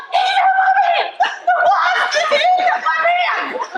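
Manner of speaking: very high, drawn-out mock scream; panicked scream. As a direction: shriek it in acted panic over a wasp. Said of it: "I am screaming".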